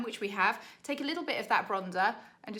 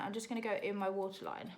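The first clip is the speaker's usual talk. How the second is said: quiet; 2 semitones lower, 6 dB quieter than usual. Real, not acted. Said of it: "I had to be quiet then".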